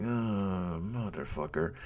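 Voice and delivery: low, quiet dread under the breath; muttered curse. Retold or imitated. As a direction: mutter it in grim resignation. Driver hears dry heaving.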